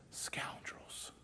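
hushed, bitter whisper. Retold or imitated. Employee telling a newscaster, in a whisper. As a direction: breathe the word out in disgust.

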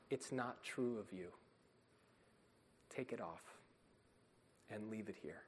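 quiet tender call; gentle divine voice. Acted. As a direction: speak as a loving father to his child. Voicing the Heavenly Father gently.

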